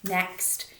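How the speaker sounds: ordinary delivery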